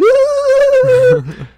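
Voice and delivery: imitated laugh, very high and loud; long shrieking mock cackle; Brandon. Imitating a bandmate's laugh after the fight, high mock cackle. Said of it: "I could still hear Brandon's laugh".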